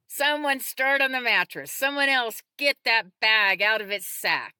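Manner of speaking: high, loud commands to the group; calling out orders. A narrator's reading. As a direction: take charge and project. Leader calls out orders to group.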